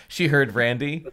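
ordinary delivery

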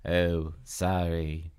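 low, very drawn-out apology; sluggish character voice. A narrator's reading. Dina apologizes on the phone, low and drawling. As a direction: drag the words out low.

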